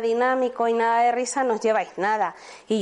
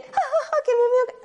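much higher, acted roaring laugh; demo belly laugh; 11 semitones higher than usual. Shown as a demonstration. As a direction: show a helpless belly laugh. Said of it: "esa carcajada que sale de aquí dentro".

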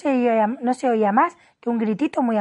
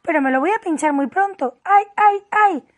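raised pitch breaking into loud, high yelps; agitated queen building to howls; anticipatory wailing; 8 semitones higher, 4 dB louder than usual. A narrator's reading. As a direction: cry out before it even hurts. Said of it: "gritó la reina".

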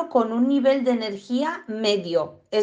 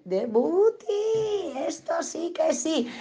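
higher, very slow and soft, savouring it; dog's smug delight; 7 semitones higher, 4 dB quieter, much slower than usual. Retold or imitated. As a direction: voice the dog loving the attention. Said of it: "el perro dice".

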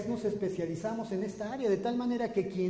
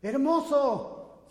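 loud, slow, high "Hermoso"; shouting a kind word into the cave; father's shout; 6 semitones higher, 7 dB louder, much slower than usual. Retold or imitated. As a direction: call it out long and proud. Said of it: "Voy a gritar".

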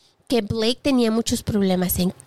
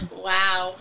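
usual speaking voice

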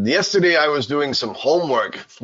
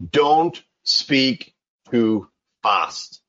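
very slow, stretched "Don't speak too fast"; deliberate slow delivery of the rule; slow emphatic advice; 4 dB louder, much slower than usual. Shown as a demonstration. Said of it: "the most important one".

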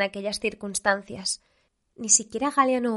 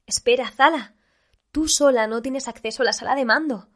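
loud, urgent shout in pursuit; calling after her; 4 semitones higher, 6 dB louder than usual. A narrator's reading. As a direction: shout to stop someone running off. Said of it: "oyó la voz de Umi persiguiéndola a trompicones".

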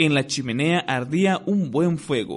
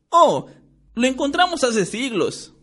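put-on higher, showy boastful boy; mildly pompous, raised pitch; grandiloquent; 10 semitones higher, 4 dB louder than usual. A narrator's reading. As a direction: puff yourself up to impress Hagrid. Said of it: "dijo Ron con aire grandilocuente".

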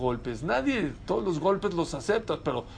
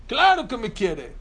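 higher, louder mock protest; insistent crying child; 6 semitones higher, 5 dB louder than usual. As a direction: insist like an upset kid, voice jumping up. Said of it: "pero llorando".

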